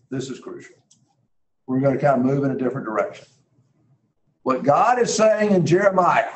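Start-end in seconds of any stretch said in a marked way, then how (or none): 0.1-0.8 breathy whispered repeat, much softer. Real, not acted. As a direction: drop to a hushed whisper for emphasis. Repeats key line, voice dropping.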